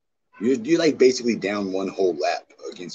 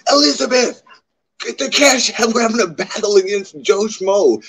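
mock whiny streamer; 12 semitones higher, 7 dB louder than usual. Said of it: "how utterly embarrassed would I be if I asked".

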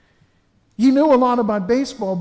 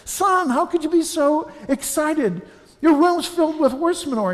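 higher, astonished dad voice; amazed father; 7 semitones higher than usual. Retold or imitated. Father amazed at son digging in manure, pitched higher. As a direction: marvel at the boy in disbelief.

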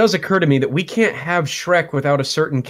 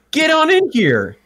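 calling out; 12 semitones higher, 3 dB louder than usual. Real, not acted. Calling the sidekick in.

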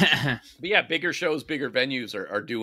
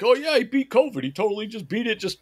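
mocking Rogan fan; 5 semitones higher than usual. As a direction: ham up a gushing idiot follower. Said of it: "his idiot followers could be like".

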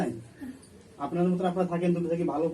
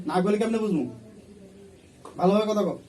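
stern demand; 3 semitones higher, 3 dB louder than usual. Real, not acted. Exorcist interrogating possessed woman.

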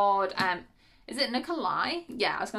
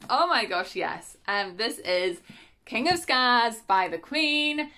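ordinary talk

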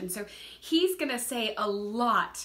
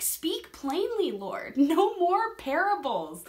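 slow, pleading and louder, begging for a straight answer; preacher acting out her own frustrated prayer, slow and insistent; exasperated prayer; 4 semitones higher, 4 dB louder, slower than usual. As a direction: plead with God, spelling out each demand slowly. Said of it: "I've prayed the words".

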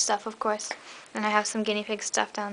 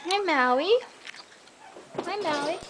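higher sing-song pet talk; cooing at a pet; 6 semitones higher, slower than usual. Real, not acted. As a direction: coo hello to a sweet little rat. Greeting her rat in cage.

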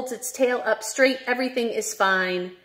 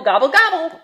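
louder, higher mock turkey gobble; 8 semitones higher, 6 dB louder, faster than usual. Reading to kids, mock turkey gobble.

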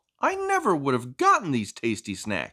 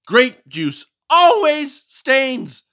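slow warning; 11 semitones higher, 9 dB louder, much slower than usual. Acted.

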